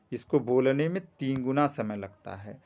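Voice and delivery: ordinary delivery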